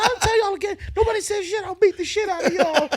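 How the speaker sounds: high, loud mock threats; imitating furious friend. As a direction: shout a warning to the whole room. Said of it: "she got so mad".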